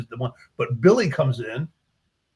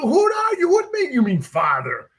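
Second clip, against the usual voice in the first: much higher, louder, affronted challenge; indignant priest; 12 semitones higher, 6 dB louder than usual. Retold or imitated. Priest confronts the gardener who calls God Father, affronted high voice. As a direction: demand who this man thinks he is.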